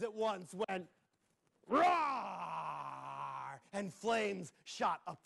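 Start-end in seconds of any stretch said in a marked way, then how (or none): 1.7-3.6 long drawn roaring cry; five-headed roar. Acted. All five dragon heads roaring at once. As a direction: let out a huge beast roar.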